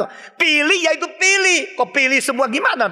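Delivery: usual speaking voice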